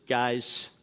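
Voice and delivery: steady captain, keep it calm; a hint of a calm pilot on the intercom. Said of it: "He says".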